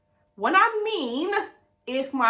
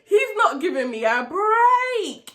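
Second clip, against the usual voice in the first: high, slow mock-crying whine; exasperated wail; 7 semitones higher, 3 dB louder, slower than usual. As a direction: wail it, stretching the last word. Exasperated wail about the caller.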